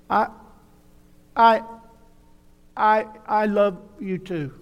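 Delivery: put-on boy stammering. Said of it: "turned around and stammered".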